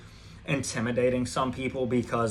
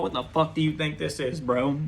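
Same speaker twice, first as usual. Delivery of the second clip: usual speaking voice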